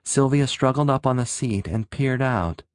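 ordinary delivery

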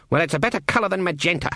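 put-on fast, dismissive old woman; brisk old witch. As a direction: rattle it off briskly. Granny brisk.

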